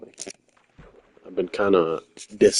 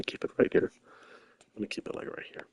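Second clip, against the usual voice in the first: whisper; 8 dB quieter than usual. Real, not acted. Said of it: "where I can talk at a monotone voice that's not too loud".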